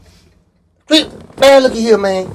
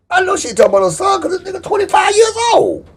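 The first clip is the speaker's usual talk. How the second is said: much higher, outraged disbelief; incredulous high squeal; 13 semitones higher than usual. Retold or imitated. Man reacting to news of her grown son, incredulous high squeal. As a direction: shoot the voice up in mock disbelief.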